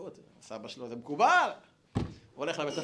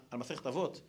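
ordinary talk

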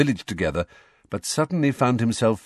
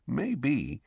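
softer, confiding suggestion; lowered voice; 4 semitones higher, 7 dB quieter than usual. A narrator's reading. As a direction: drop the volume, suggest it guardedly. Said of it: "in a lower voice".

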